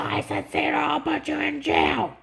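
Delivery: high, loud mock yelling; agent's angry threat.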